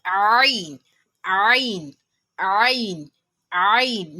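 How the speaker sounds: "ain" long and loud from the throat. Shown as a demonstration. Teacher drilling against a common mistake, throaty "ain". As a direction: repeat "ain", each swelling out of the throat. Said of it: "It works with the throat".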